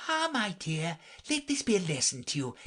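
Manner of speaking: put-on lecturing old crab; old mother crab. A narrator's reading. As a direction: moralise to a daughter. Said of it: "an old crab ... saying to her daughter".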